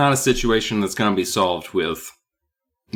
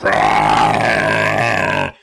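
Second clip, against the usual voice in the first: ghost roar; 5 semitones lower, 4 dB louder than usual. Acted. Ghost leaps out at them.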